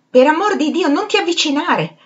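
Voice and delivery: high, loud panicked cry; frightened shout. A narrator's reading. Septimus shouting at dead Evans to keep away, high and loud. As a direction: yell it at him to stay away. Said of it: "gridò Septimus".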